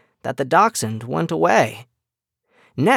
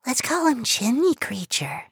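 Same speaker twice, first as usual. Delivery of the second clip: mouse voice; 10 semitones higher than usual. Acted. Mouse sister naming creature.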